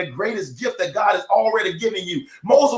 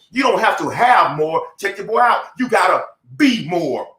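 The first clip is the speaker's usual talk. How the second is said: ordinary talk, nothing marked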